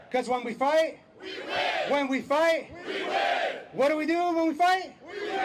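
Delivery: shouted call to the crowd; rally speech. Real, not acted. Call and response chant.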